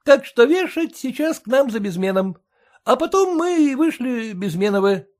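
bow and scrape to the master; humble clerk. A narrator's reading. Clerk's voice in story.

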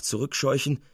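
ordinary delivery

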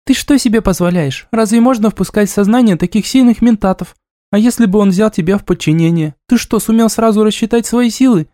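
high-pitched nagging scold; scolding girl. Acted. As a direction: tell him off, exasperated. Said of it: "тут же напустилась на него". (отчитывает высоким голосом)

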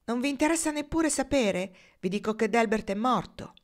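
high-pitched, pressing disbelief; exasperated insistence. A narrator's reading. Foxy asking Marnie if she even cares, exasperated. Acted insistence on being heard.

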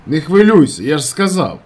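loud, high reply; shouted reassurance. A narrator's reading. Narrator calming his friend, shouting. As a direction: insist down the line.

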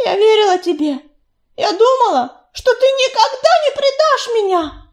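high, loud, betrayed; a young she-cat spitting out her betrayal; hateful parting shot. A narrator's reading. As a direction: hurl the words at him and turn away. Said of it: "С ненавистью бросила она".

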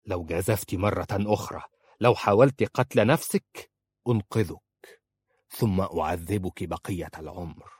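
ordinary delivery

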